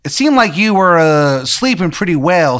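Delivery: hammed-up Maryland local explaining himself; terrible Maryland accent. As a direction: put on a bad Maryland accent. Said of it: "he's got, like, a terrible Maryland accent".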